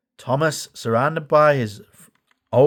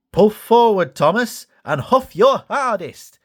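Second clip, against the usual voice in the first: much higher, louder call; Bash urging; 8 semitones higher, 4 dB louder, slower than usual. A narrator's reading. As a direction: urge Thomas on. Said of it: "Bash suggested".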